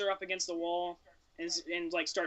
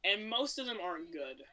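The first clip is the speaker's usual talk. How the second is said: ordinary talk, nothing marked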